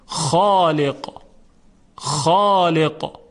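خالق with the ا thick, full and drawn out; heavy alif. Shown as a demonstration. Teacher showing students the heavy خالق, slowly and full. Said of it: "به این صورت".

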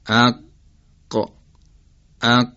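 "اق" stopped at the back of the tongue; vowel, hard uvular q stop, short release on "اق"; demonstrating qaf. Shown as a demonstration. Teacher showing where qaf is articulated, isolated "اق" demonstration. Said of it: "کام بالاست که مخرج قافه،".

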